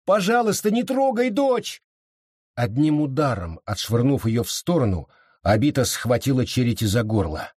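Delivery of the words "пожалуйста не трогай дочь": high, imploring mother's cry; desperate plea. A narrator's reading. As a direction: beg for your daughter, pitched very high. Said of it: "взмолилась Энн".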